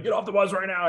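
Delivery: imitating a screaming drill instructor getting recruits off the bus; lightly voiced barking sergeant; drill instructor frog voice. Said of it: "what they call the frog voice".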